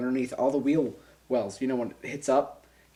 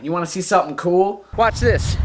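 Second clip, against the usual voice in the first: put-on raised, louder call to the kids; cocky show-off; 3 semitones higher, 6 dB louder than usual. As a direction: show off to onlookers, pitch lifting. Calls to kids from car window.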